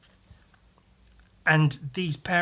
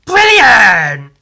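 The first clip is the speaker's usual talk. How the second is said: high, very loud triumphant cry; loud thrilled whoop; delighted exclamation; 7 semitones higher, 16 dB louder than usual. Real, not acted. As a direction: burst out in delight at the finished picture. Delighted at finished drawing.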